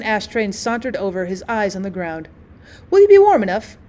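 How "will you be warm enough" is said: higher, louder fussing concern; solicitous. Acted. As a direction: fuss over him tenderly.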